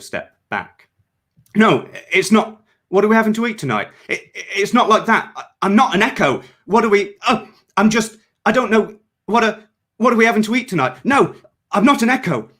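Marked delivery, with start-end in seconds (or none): 1.5-12.5 high, fast panicky babble; panicked rambling. A narrator's reading. Cleanser begging his comrades, panicked and babbling fast. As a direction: scramble to deny it, losing control. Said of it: "As he rambled, his panicked face".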